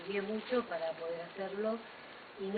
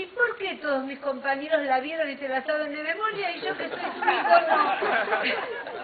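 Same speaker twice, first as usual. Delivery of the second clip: put-on indignant child; 7 semitones higher, 10 dB louder than usual. Son complaining to his mother, aggrieved child voice. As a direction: complain like a ten-year-old left out.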